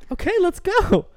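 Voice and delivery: very high squeaky agreement; high mock consent; mock eager woman. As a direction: squeal it out, overeager. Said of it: "what she say".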